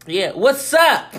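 slow, drawn-out mock "what's up"; hammed-up, over-eager slang hello; imitated try-hard greeting. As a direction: imitate someone forcing slang, stretching the greeting. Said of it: "they was trying to ... be like".

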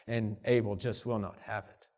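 ordinary talk, nothing marked

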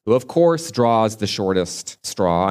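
ordinary talk

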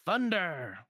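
high spell-casting cry; Donald yelling. Acted. Donald waves his wand at the hooded man, yelling a spell. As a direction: yell the spell, pitch up. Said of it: "waves his wand and yells".

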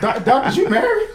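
high, laughing flirty tease; flirty mock voice. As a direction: coo at the doctor playfully. Joking flirt with chiropractor.

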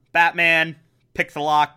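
ordinary talk

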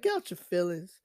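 blunt rebuke. Retold or imitated. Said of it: "he talked to me like that".

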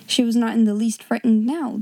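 usual speaking voice

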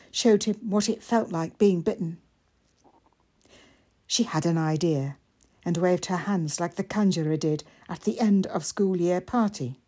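ordinary delivery

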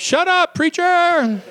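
very high, squeaky "Shut up, preacher"; squeaky mock heckle; mock heckler. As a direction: voice the interrupting noise as a cheeky heckler. Joke voicing a heckling noise.

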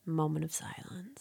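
mock-solemn whisper; hushed whisper. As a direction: whisper it like a eulogy.

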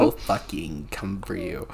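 low, slow mock threat; mock menace. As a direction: growl it back as a playful menace. Playful threat back.

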